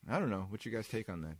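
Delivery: normal talking voice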